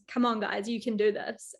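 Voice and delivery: normal talking voice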